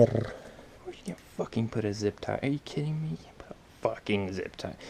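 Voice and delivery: exasperated half-whispered cursing; real hushed frustration, nearly voiceless; frustrated muttering. Real, not acted. Frustrated at an unreachable zip tie.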